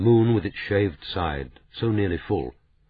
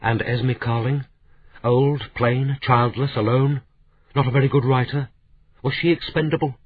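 higher, louder indignant outcry; crying out; 7 semitones higher, 4 dB louder than usual. Acted. Kate confronting Daniel over Esme's death: higher, louder outcry. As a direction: fling each question at him, rising. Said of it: "She cried".

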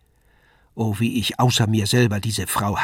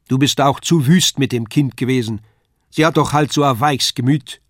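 raised-voice dialect reproach; loud reproach; 4 semitones higher, 7 dB louder than usual. A narrator's reading. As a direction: scold your wife across the hall. Husband reproaches wife across hall.

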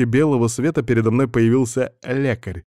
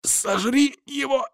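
Сожри его! squeezed out high with no air; choked hiss; 3 dB quieter, slower than usual. A narrator's reading. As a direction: hiss the command while being choked. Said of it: "прошипел из-за нехватки воздуха".

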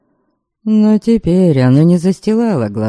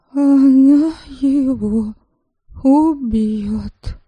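slow, resigned sad remark; 8 semitones higher, much slower than usual. Acted. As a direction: say it like a sad verdict. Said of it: "печально".

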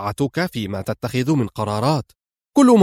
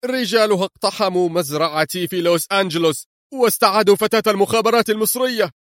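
much higher, fast, frightened rush; hoarse, terrified voice; 8 semitones higher, faster than usual. A narrator's reading. Gomani telling his lawyer of the raid: terrified fast higher voice. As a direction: gasp it out in panic. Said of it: "قبل أن يقول بصوت متحشرج مذعور".

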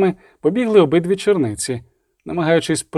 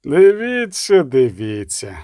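mocking, teeth bared; slower than usual. A narrator's reading. Bishop sneering at news of a witness, mocking drawl. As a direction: feign interest slowly through a toothy grin. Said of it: "вишкірив зуби Конрад Олесницький".

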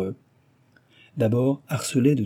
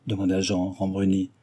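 ordinary delivery

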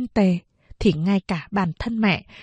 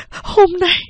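"hôm nay" much higher and louder, breaking out; louder, higher repeat of "hôm nay"; rising outburst; 10 semitones higher, 6 dB louder than usual. A narrator's reading. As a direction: burst out on the second "hôm nay". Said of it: "Mẹ nói tiếp".

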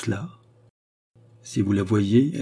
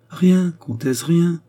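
high, raised lover's outburst; impassioned exclamation; 8 semitones higher, 5 dB louder, much slower than usual. A narrator's reading. Lovesick cardinal protesting to the countess, crying out ardently. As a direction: cry it out, louder and pitched up. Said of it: "s'écria l'amoureux prélat".

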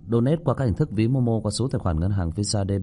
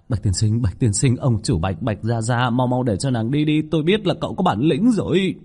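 rushed begging, climbing much higher; panicked pleading; 4 semitones higher, 5 dB louder than usual. Acted. Captain begging owner to send her away, panicked pleading. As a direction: beg frantically, pitch rising to the end. Terrified man begs ghost be removed.

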